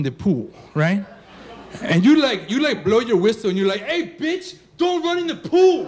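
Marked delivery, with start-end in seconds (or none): 3.8-5.8 high-pitched lifeguard holler; lifeguard yell. Acted. Lifeguard hollering at a kid running by the pool, yelling. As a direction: shout a loud, high warning across the pool.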